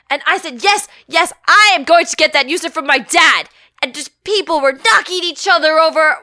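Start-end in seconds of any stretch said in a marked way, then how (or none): 0.6-3.5 high, loud eager yell; excited shouting. Retold or imitated. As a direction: blurt it like a converted believer. Caught up in the frenzy.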